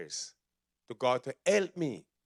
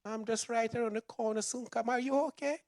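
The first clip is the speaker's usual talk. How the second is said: voicing the wife; 9 semitones higher than usual. Wife voiced on the phone.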